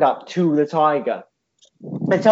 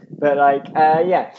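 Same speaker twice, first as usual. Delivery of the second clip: ordinary delivery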